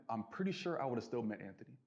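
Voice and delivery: ordinary delivery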